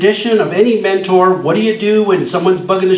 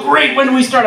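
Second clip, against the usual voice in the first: eager question; 8 semitones higher than usual. Retold or imitated. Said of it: "And they go".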